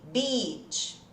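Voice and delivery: "beach" with a long I sound, not a short one; long ee vowel. Shown as a demonstration. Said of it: "Som de I". ("beach" com som de I longo)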